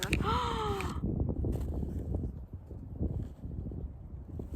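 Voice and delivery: long, breathy gasp of wonder; drawn-out amazed intake of breath; a real gasp at a lovely surprise; gasp of delight. Real, not acted. Opening a gift of decks.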